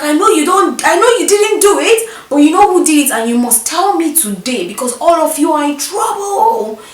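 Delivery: high, loud pressing demand; stern matron. Retold or imitated. Matron demanding the culprit's name while caning, high loud insistence. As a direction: squeeze a confession out while caning. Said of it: "She was like".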